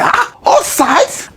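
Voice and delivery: high, drawn-out incredulous "all sides"; mocking echo. Mocking the government's "all sides" line, disbelieving and drawn out. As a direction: sneer at the official phrase.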